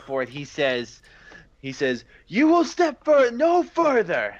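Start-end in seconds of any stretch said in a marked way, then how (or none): 2.3-4.3 high, loud and furious; angry shout. Acted. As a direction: bark the order to stop. Angry king halting intruder.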